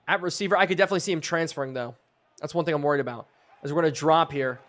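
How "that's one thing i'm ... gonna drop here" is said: ordinary delivery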